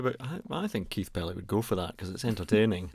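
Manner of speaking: ordinary delivery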